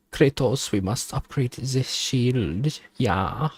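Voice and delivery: a lightly hammed German advisor urging Kratos; German accent. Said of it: "Why are you speaking like this?".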